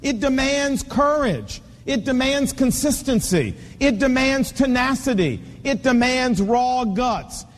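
slow, weighty sermon build; a preacher driving the point to men; preaching cadence. Acted. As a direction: hammer each "demands" home.

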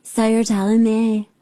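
lightly voiced vocal fry impression; mock her creaky drawl; a hint of Jill Biden's fry. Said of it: "I feel like I should be her for Halloween".

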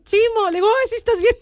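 high, bright burst of relief; relieved joy. Retold or imitated. Mother greeting her son as he wakes, relieved and high.

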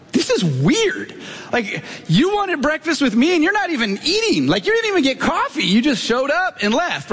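high-pitched, exasperated mock complaint; acted-out indignant disbelief; incredulous. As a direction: replay your bafflement, pitch shooting way up. Acted incredulous reaction to odd meeting.

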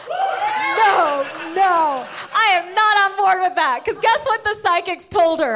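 No way, not on board, said loud; indignant loud protest. Real, not acted. To the audience about her mom, an outraged loud protest.

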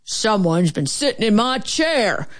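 growling grouchy bear voice; great big grumpy growl. A narrator's reading. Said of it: "great big grouchy voice".

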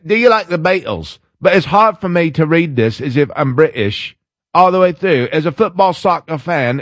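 put-on old British guy accent; put-on British English accent. Shown as a demonstration. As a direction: read it as an old British bloke. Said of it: "should I read the whole thing in my British accent".

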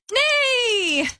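high, drawn-out whinny; horse neigh. Shown as a demonstration. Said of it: "there's no onomatopoeia".

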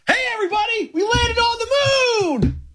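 high, drawn-out mock proclamation; mock shout. Co-host interrupting with a hammed-up slow shout. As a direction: shout it slowly like breaking historic news. Mock announcing moon landing.

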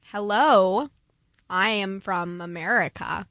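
slow, careful American accent; deliberate mock-American delivery; attempted American accent. Shown as a demonstration. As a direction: try on an American accent, word by word. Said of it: "could do it if I tried".

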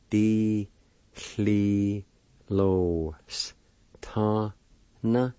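"Di-hli-lo-s-ta-na" very slowly, each syllable held and separate. Shown as a demonstration. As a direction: stretch "Di-hli-lo-s-ta-na" out with a pause after each syllable. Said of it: "It's pronounced like this".

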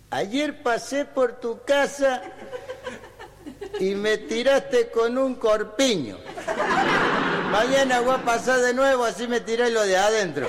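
high, drawn-out declaimed couplets; folk verse recitation. Acted. Reciting a Córdoba folk relación to the audience, high declaiming. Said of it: "una relación así cordobesa".

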